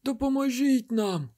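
high desperate cry for help; high frantic call. A narrator's reading. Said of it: "кликали вони".

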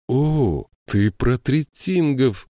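slow, dawning realisation; slowly. A narrator's reading. Joshua slowly grasping what she means. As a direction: draw it out as it sinks in. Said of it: "медленно проговорил Джошуа".

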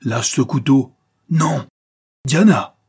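high, loud, urgent orders; fierce acted quarrel; struggling shouts. A narrator's reading. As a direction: shout as if fighting over a knife. Acted struggle over a knife.

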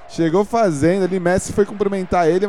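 normal talking voice

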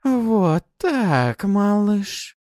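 high, loud, caressing coo; affectionate. Acted. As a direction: coo it tenderly. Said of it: "ласково произнесла она".

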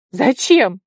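loud, startled cry; teeth-chattering question. A narrator's reading. Tigir questions an order in icy wind, loud cry. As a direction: blurt the question out loudly. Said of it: "простучал зубами Тигир".

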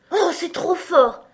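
high, loud, drawn-out cry; indignant exclamation. A narrator's reading. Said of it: "s'écria-t-elle". (exclamation indignée, aiguë et forte)

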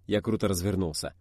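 ordinary delivery

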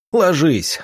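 sharp, high urgent order; barked command. A narrator's reading. Rodion warning companions as a gun is aimed, barked order. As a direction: bark it out to make them duck. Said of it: "рявкнул Родион".

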